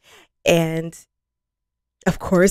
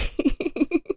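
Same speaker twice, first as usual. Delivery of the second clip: giddy giggle; 8 semitones higher, 6 dB quieter than usual. Real, not acted. Giggling after the line.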